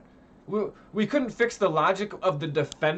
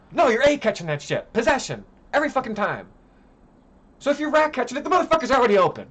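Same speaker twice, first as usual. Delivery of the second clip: louder and higher, heated; angry rant; 7 semitones higher, 5 dB louder, faster than usual. Real, not acted. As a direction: rant hard, voice up and pushed.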